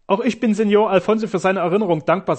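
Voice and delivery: put-on proud doctor answering the young count. A narrator's reading. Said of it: "sagte Sternau stolz".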